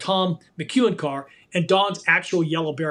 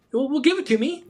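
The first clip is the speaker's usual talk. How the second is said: dry, sarcastic, higher retold line; imitating a sarcastic racer, dry and higher; deadpan sarcasm; 8 semitones higher than usual. Retelling McEwen's dry jab at the kid, deadpan sarcastic. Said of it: "McEwen is such a deadpan. He was so sarcastic".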